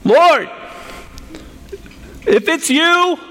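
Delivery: high, full-voiced shout with long pauses; screaming over a storm. Peter screaming to Jesus from the boat in the dark, shouted. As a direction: yell across wind and crashing waves. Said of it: "I would imagine he's screaming this".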